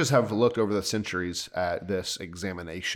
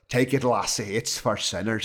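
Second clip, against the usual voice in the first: put-on Scottish brogue; Scottish accent. Retelling his story's line to the co-host, Scottish accent. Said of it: "In his, in his Scottish accent".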